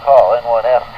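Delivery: ordinary talk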